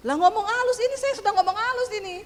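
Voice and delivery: high, loud indignant comeback; angry retort. Retold or imitated. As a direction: insist loudly and high that this is gentle. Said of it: "Malah marah".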